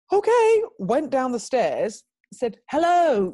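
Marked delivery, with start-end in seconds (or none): 0.1-0.6 high, loud, drawn-out "okay"; big bold high-pitched okay. Retold or imitated. Acted plucky step forward. 2.7-3.3 loud, high greeting to a stranger; big high hail; bright-pitched calling hello. Retold or imitated.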